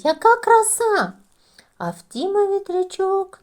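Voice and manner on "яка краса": high, loud delighted cry. A narrator's reading. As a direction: gasp with joy at the pinwheel. Діти радіють вітрячкам. (високий захоплений вигук)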